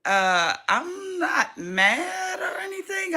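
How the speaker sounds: imitating her own overly sweet reply to the teacher; mock innocent reply. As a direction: put on an exaggerated innocent voice. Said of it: "And I was like".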